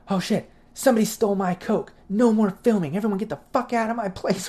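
high, outraged rant at the crew; playing the furious host accusing everyone; pissed off. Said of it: "he was, like, pissed".

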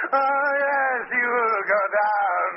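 high, slow, menacing gloat; madman. Acted. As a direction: drag it out like a lunatic. Madman gloating over his victim.

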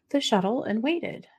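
ordinary talk, nothing marked

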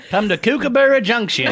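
put-on Australian accent. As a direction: sell it like an Aussie ad. Continuing Australian bit.

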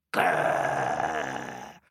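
deep low growl; dog growl. Acted. Dogs chasing monkey, deep growl.

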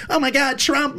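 high-pitched mock horror; mocking horrified critics. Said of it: "they see alpha male, they think trafficker".

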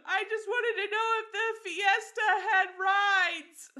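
high, loud, slow wail, laughing through it; mock crying little boy. A crying little boy tells his aunt what he meant, in a high, loud, laughing mock wail. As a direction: wail it like a bewildered sobbing toddler. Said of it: "just starts crying 'cause he doesn't know why".

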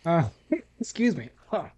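coughing while speaking; choked up after a sip; choking on a drink. Real, not acted. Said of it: "It's all choked up".

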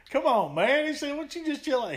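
high, laid-back surfer voice; imitating a mellow surfer buddy; surfer dude. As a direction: play the chill surfer with a beer. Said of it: "He was more the surfer type dude".

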